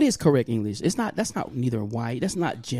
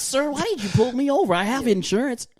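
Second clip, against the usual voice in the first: higher-pitched mock protest to an officer; pleading pulled-over driver; 9 semitones higher than usual. Driver pulled over by police, mock pleading. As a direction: plead your case in a put-on proper voice. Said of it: "You get pulled over".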